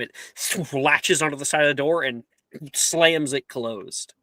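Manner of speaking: usual speaking voice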